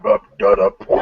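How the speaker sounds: high-pitched mocking gibberish; mimicked nonsense catchphrase. YouTuber mimicking a rival's stupid catchphrase, high gibberish. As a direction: babble it high, imitating a silly catchphrase.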